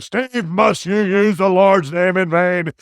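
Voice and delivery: slow, loud mock-angry scolding; put-on cross voice, loud and drawn out; Rude getting mad. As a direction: scold indignantly, drawing the words out. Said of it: "he'd get mad".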